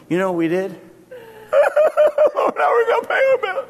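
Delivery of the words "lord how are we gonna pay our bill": high, loud panicked wail; panicked cry to God. Retold or imitated. Preacher mimicking a frantic prayer over unpaid bills, high and loud.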